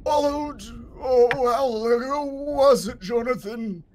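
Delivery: high, slow, moaning undead noble; zombie lord. Acted. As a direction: call out like a lost old zombie lord.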